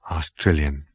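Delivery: ordinary delivery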